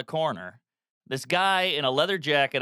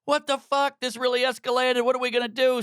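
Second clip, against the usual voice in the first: higher, panicky rising voice; a touch of panic, pitched up; freaking out; 9 semitones higher than usual. Retold or imitated. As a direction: sound like it all just got out of hand. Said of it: "And I'm like".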